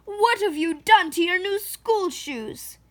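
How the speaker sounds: high, loud dismayed scold; upset scolding. Acted. Mama scolds Jamila over the shoes, upset and loud. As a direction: scold in disbelief. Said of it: "she cried".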